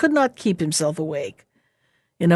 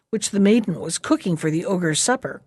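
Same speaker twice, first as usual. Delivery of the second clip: ordinary delivery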